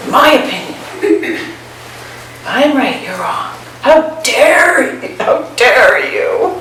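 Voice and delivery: high, slow, huffy outrage; indignant self-righteousness. Retold or imitated. Mocking the tight-fisted mind, high and indignant. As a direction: play the offended know-it-all on a high horse.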